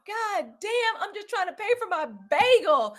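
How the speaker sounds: high, loud retold exasperation; exasperated outburst. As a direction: cry out as if fed up at the counter.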